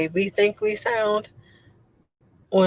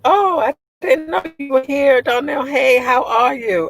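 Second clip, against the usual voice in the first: bright, raised surprised hello; higher and louder happy welcome; excited greeting; 7 semitones higher, 4 dB louder than usual. Real, not acted. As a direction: greet a friend who just walked in. Surprised greeting to arriving viewer.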